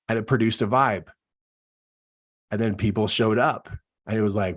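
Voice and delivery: ordinary talk, nothing marked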